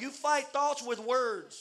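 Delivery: ordinary delivery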